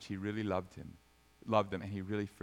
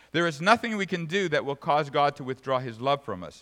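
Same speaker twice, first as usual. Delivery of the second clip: loud, emphatic voice climbing higher; forceful preaching; 5 semitones higher, 8 dB louder than usual. Real, not acted. Preacher warning church of unconfessed sin, forceful preaching. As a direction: build in force and pitch to a warning.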